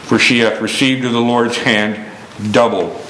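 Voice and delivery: hushed closing reading. Acted. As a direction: let the last verse fade gently.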